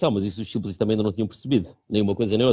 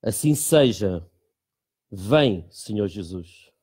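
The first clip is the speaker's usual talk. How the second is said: prayer; 5 semitones lower, much slower than usual. Shown as a demonstration. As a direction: lead the prayer slowly and solemnly. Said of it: "depois de eu ler esta frase como oração".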